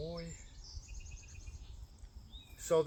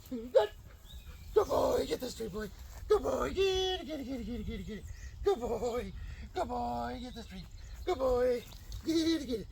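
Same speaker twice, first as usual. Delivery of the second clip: much higher, excited praise; high happy praise; 7 semitones higher than usual. Real, not acted. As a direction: cheer him on like a fan. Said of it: "High and happy tone of voice".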